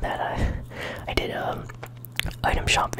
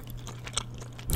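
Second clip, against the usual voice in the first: whispered laugh; 11 dB quieter than usual. Real, not acted. Laughs at enemy in game.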